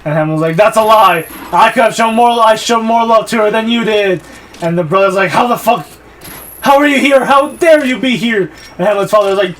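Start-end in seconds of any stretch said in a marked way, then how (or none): 0.6-4.2 loud, high hammed-up shouting; mock angry retort. Acts Hamlet's quarrel with brother. 5.3-8.5 mock furious challenge. Acts brother confronting Hamlet.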